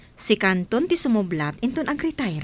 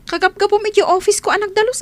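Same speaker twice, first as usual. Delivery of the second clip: scolding boss; 9 semitones higher, 5 dB louder than usual. Acted. Boss scolds cleaner about office.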